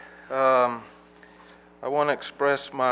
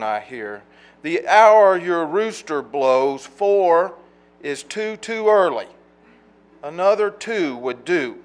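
higher, louder poem recital with weighty pauses; poetry reading; 7 semitones higher, 13 dB louder, slower than usual. Acted. Poet addresses a neighbor about his early rooster, declaimed poetry reading. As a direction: recite it as a spoken formal complaint.

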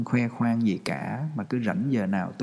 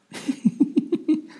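breathy laugh. Real, not acted. Laughs at silent audience.

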